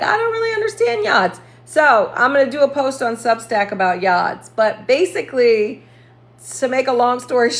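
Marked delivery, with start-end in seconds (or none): 0.0-1.4 very high, mock-confused voice; put-on high-pitched helpless whine; imitating clueless self. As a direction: squeak it out as your baffled former self. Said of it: "You know, I'll be like".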